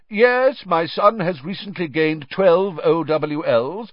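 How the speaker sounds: lightly voiced deranged official; fluent rambling. A narrator's reading. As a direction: chat on as if in the office. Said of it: "talking fluently to a tree".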